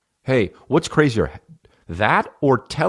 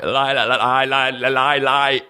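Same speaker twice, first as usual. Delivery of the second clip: imitating a stammer stuck on lie, pitched higher; stuttering king; 5 semitones higher, slower than usual. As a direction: stammer through lie, lie like the King's Speech. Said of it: "where he's just like".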